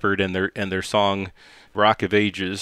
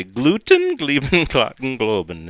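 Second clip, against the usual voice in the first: fake German; 4 semitones higher than usual. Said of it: "I say".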